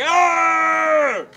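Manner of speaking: a loud, high, aggressive yell with no words. Shown as a demonstration. Showing how yelling at a crowd sounds aggressive, loud yell. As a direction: bark out one harsh shouted ah. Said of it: "it always sounds fairly aggressive".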